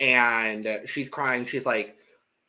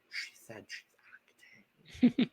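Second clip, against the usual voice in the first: slow, voiceless mouthed aside; mouthed whisper; 3 semitones lower, 9 dB quieter, slower than usual. Retold or imitated. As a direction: mouth it silently across the room.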